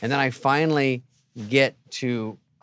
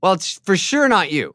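loud, higher, cutting comeback; sarcastic retort; 8 semitones higher, 6 dB louder than usual. Retold or imitated. The comedian fires back at the squatter, loud and sarcastic. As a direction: snap back at him with sass.

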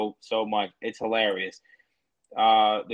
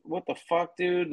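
mock outrage; 6 semitones higher than usual. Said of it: "And I'm like".

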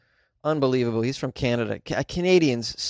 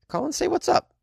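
squeaky. Pretend. Sudden squeaky high line.

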